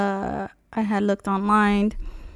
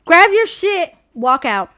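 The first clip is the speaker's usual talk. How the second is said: punchy, higher and louder; forceful command; 7 semitones higher, 6 dB louder, slower than usual. Retold or imitated. Advising a friend at the dealership, forceful. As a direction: snap out the order to walk away.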